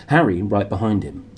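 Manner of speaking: usual speaking voice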